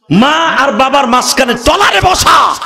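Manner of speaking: impassioned shout. Real, not acted. Preacher's emotional climax.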